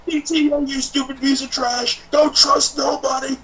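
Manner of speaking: hammed-up trash talk, spat out at the boss; Bayley doing Stone Cold. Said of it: "just goes".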